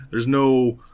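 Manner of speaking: normal talking voice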